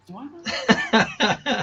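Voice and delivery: slow, high, laughing puzzlement; confused celebrity. Retold or imitated. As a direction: trail off unsure who she is. Mario unsure, retold.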